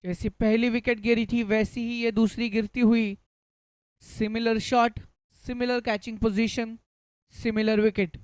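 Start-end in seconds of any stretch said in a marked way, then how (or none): none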